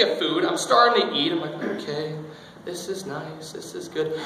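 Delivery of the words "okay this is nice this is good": quiet contentment. Retold or imitated. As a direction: murmur it softly, unsuspecting. Eating calmly before the surprise shot.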